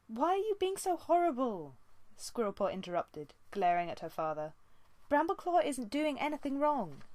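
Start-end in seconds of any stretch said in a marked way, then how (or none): 0.1-1.7 a higher, louder protest against her father; angry daughter. A narrator's reading. 5.1-7.0 a higher, louder protest against her father; angry daughter. A narrator's reading. Daughter defends Brambleclaw.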